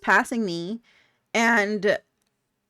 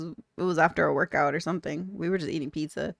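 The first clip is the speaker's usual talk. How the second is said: usual speaking voice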